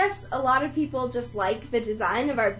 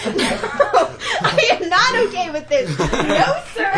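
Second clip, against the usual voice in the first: higher, laughing imitation of outrage; mock yell; 6 semitones higher, 4 dB louder than usual. Friend retelling Ben confronting a picture-taker, mock yell. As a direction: act out his indignant refusal through laughter. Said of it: "yelling at this guy".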